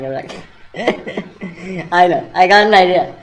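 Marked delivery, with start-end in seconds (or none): 0.4-1.9 light mock elf giggle; a playful, put-on little laugh. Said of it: "the elves were laughing". 1.9-3.2 loud, eager exclamation; hammed-up lightbulb moment; excited idea.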